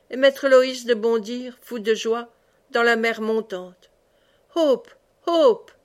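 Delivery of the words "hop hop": high, loud call to the horse; urging cry. A narrator's reading. Aubry urges the horse into the sea, calling loud. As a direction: spur the horse on with the voice. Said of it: "cria Aubry à son tour".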